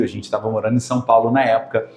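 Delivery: ordinary talk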